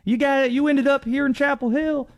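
imitating a Southern local reporter; Southern accent. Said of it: "ask Bill questions in Southern accents".

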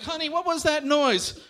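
a hint of a startled neighbor; lightly voiced neighbor. Voices a neighbor hearing the screams.

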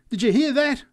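high, loud, stressing hear; excited repeat again. A narrator's reading. Drunk repeating to the crowd, stressing hear. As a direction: press the crowd, punching hear. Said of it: "kept repeating".

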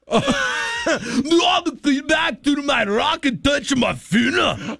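radio host playing a German whale, shouting high in a hammy German accent. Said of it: "a whale off of German waters".

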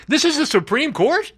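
high, disbelieving question; incredulous. Real, not acted. Host scoffing at the Supreme Court on air, high and incredulous. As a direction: ask it like you can't believe it.

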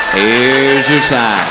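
slow drawn-out Here's your sign, your stressed; slow punchline. Acted. Comic to the audience, slow deadpan punchline. Recurring punchline delivered slowly.

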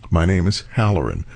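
low-pitched deadpan introduction; deadpan correction. Pretend. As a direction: state the name low and final. Corpse correcting eulogist's pronunciation.

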